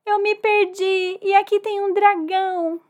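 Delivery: high, loud acted wail; hammed-up tearful cry, stretched out; crying boy. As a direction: wail for help like a lost child. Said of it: "ele lá do outro lado chorando".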